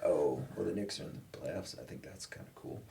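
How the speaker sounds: retold sheepish reply, fading very soft; deflated mumble. Rebuffed student still making small talk, deflated. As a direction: shrink away to almost nothing.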